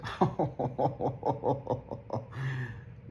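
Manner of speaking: a light, gentle chuckle; soft motherly laugh. Acted. Mother raccoon chuckles at her son's worry, softly. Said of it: "Missus Raccoon laughed".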